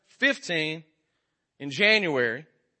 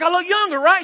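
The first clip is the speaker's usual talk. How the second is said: hopeful husband; 12 semitones higher, 5 dB louder than usual. Retold or imitated. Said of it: "And I was like".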